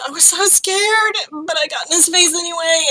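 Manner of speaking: crying. Acted. Astrid crying as she tells the whole thing, tearful wail. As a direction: blurt it out through mock sobs. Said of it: "Astrid is just crying".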